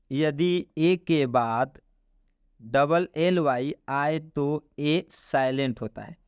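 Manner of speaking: slow, deliberate teacher rule; slow rule dictation. Shown as a demonstration. Teacher slowly dictating the rule to students. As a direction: spell the rule out slowly for the class. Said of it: "teacher stating the rule slowly".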